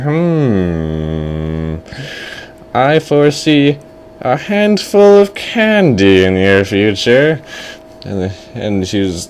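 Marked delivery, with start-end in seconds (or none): 0.0-7.4 long hum, then slow, drawn-out prediction; mystic fortune teller. Acted. Fortune teller foresees candy for the boy, slow mystic voice. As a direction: intone it slowly like a kooky seer. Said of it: "And the fortune teller's like".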